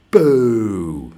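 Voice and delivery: loud football-crowd boo; drawn-out boo. Shown as a demonstration. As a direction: boo long from the terraces. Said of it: "hold a football and go".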